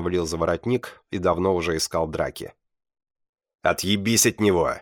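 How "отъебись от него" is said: rough, forceful warning to back off; angry bark, held short of shouting; barked order. A narrator's reading. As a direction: bark it at a bully. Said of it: "рявкнул Дэн".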